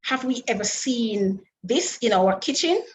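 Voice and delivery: ordinary talk, nothing marked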